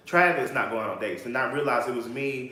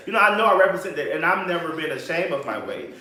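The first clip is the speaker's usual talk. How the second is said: normal talking voice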